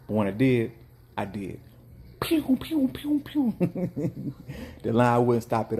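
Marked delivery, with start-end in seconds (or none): 2.2-3.5 high quick pew pew noises; sound effect. Retold or imitated. Vendor mimics rapid serving, high pews. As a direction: fire off pews like a laser.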